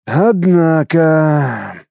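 very slow, drawn-out word; lost, lingering astonishment; bewildered drawl. A narrator's reading. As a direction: stretch it out in confusion. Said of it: "протянул растерянно Цаплин".

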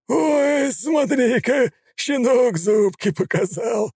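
high, slow, jeering yell; mocking shout. A narrator's reading. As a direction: jeer at the puppy's defiance. Nika mocking, laughing.

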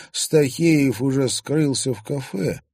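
ordinary talk, nothing marked

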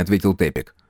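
ordinary delivery, nothing marked

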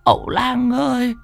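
high, strained begging voice; a frightened father begging the shaman; urgent high plea for his lost daughter; desperate pleading. Acted. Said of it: "nghe tiếng gọi".

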